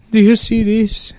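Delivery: slow, high mock-weepy voice; hammed-up, pitiful accented question; imitating tearful man. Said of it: "he's, like, tearing up".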